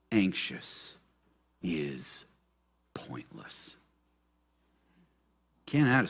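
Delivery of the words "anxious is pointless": fading to a whisper, word by word; dramatic whisper. Acted. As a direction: let each word sink into a hush. Preacher repeats line, whispered for effect.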